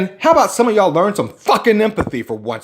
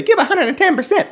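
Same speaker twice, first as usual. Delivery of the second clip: mock coach; 11 semitones higher, faster than usual. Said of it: "I know coaches say".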